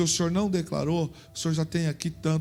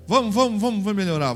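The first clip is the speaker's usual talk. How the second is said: rallying the crowd; 5 semitones higher, 5 dB louder, faster than usual. Real, not acted. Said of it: "urging the crowd".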